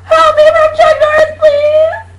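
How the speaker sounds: very high, very loud desperate yell; a shrill cartoon cry for rescue; yelling at the top of lungs. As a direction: scream for help as loud as you can. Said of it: "yell at the top of your lungs".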